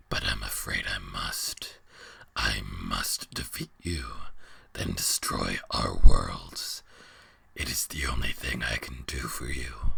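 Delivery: a weary giant creature rumbling from the dark; ancient beast. A narrator's reading. Beast declares he must fight.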